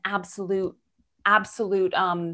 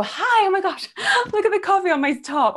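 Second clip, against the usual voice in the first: much higher, louder, laughing greeting; cheery self-mocking; 10 semitones higher, 4 dB louder than usual. Arriving late to an interview, laughing at her coffee stain. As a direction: laugh it off brightly, pitch way up. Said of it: "I'm gonna go".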